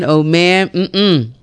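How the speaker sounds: ordinary delivery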